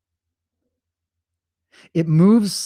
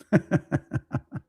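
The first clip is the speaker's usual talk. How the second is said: short laugh; 6 semitones higher, 4 dB quieter than usual. Real, not acted. Laughs after demons joke.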